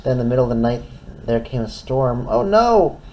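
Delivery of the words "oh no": alarmed. Acted. Storm comes.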